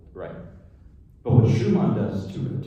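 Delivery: usual speaking voice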